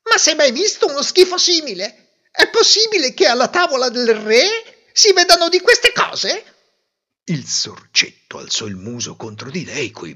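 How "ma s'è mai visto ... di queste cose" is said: very high, loud disgusted tirade; a fussy lady who can't hold it in; outraged outburst. A narrator's reading. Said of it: "sbottò". (sfogo indignato, acutissimo e forte)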